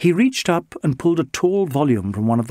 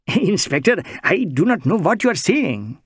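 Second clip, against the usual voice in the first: put-on higher, lilting Indian-accented voice, anxious and earnest; Bengali social worker; 6 semitones higher than usual. Bengali social worker protesting to the inspector, higher Indian-accented voice. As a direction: play the mild Bengali gentleman pleading his innocence.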